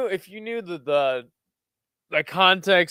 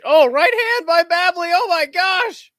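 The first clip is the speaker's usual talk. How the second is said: excited outburst; 13 semitones higher, 7 dB louder than usual. Real, not acted. Commentator calling a big right hand, excited. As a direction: burst out at the big punch. Live call of big punch.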